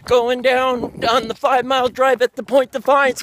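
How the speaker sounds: usual speaking voice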